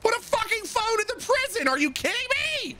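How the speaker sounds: very high-pitched exasperated yelp; outraged disbelief. Real, not acted. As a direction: squeal the demand in frustration. Rant at game's missing phone.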